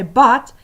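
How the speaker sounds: loud, emphatic "But"; demanding attention. Shown as a demonstration. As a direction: hit "But" hard to make them listen. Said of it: "then you must listen to me".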